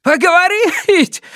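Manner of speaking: high shout breaking at the end; voice cracking. A narrator's reading. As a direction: yell in chunks until the voice gives way. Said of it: "На последних словах его голос сорвался". (крик, срывающийся на последних словах)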